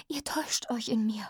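urgent, breath-only whisper; hushed, despairing plea; desperate whisper. A narrator's reading. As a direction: whisper it pleadingly. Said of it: "flüsterte ich verzweifelt".

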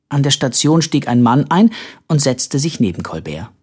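ordinary delivery, nothing marked

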